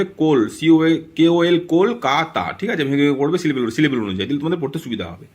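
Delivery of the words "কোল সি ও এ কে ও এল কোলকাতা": "কোল" and "কাতা" read slowly, syllable by syllable, each syllable spelled out; broken into syllables. Shown as a demonstration. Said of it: "Kolkata তোমরা এভাবে ভেঙে ভেঙে পড়বে".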